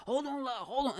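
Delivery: high-pitched mock call to wait; imitated yelling. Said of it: "It's just like somebody yelling".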